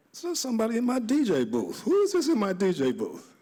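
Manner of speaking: high-pitched mock indignation, falling at the end; who dares be in your booth; acted-out affronted disbelief; retold indignant surprise. Retells finding intruder in his booth.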